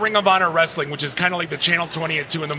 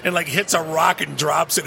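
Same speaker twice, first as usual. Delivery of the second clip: normal talking voice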